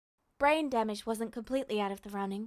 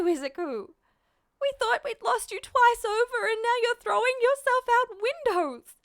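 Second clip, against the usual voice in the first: high, loud, anguished lament; despairing mother; 10 semitones higher, 5 dB louder than usual. Acted. Mother despairing over her son's hero career, anguished. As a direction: pour out worn-out despair. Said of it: "buried her face in her hands".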